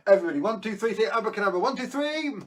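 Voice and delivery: high, loud, fast countdown cheer; rally the kids to shout along; kids party magician. Acted. Magician leads kids' countdown.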